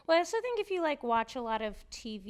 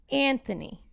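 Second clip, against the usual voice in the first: lightly imitated North Jersey Italian woman, louder; North Jersey Italian accent; 2 semitones higher, 8 dB louder than usual. Woman imitating how North Jersey Italian women say a name, North Jersey Italian accent. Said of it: "the way that North Jersey Italian women say".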